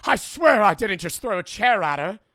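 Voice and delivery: high, loud panicked protesting; mock desperate defendant; frantic husband's excuse. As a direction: protest your innocence, hammed-up. Acts husband's frantic excuse for the assault.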